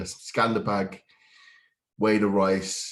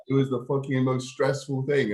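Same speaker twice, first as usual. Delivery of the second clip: ordinary talk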